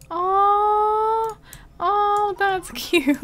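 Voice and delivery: high, drawn-out aww; high-pitched gushing coo; adoring coo. Real, not acted. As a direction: melt at something cute, with a laugh. Melting at cute game moment.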